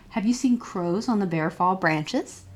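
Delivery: plain reading voice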